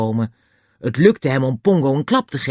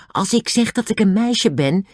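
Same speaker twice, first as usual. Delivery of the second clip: ordinary delivery, nothing marked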